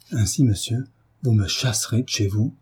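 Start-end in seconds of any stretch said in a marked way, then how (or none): none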